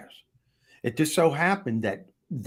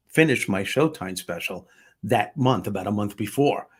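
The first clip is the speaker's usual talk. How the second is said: usual speaking voice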